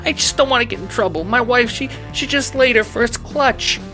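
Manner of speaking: very high, fast, frightened pleading; panicked stammer. A narrator's reading. Employee pleading with the mob boss, high nervous stammer. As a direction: squeal it out in a hurried panic. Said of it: "you stammer".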